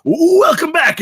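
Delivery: high eager stammer; too keen to see him; overeager welcome. Acted. Bigfoot greeting Anthony at the door, overeager. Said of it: "maybe a little too eagerly".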